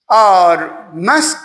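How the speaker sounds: usual speaking voice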